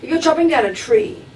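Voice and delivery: usual speaking voice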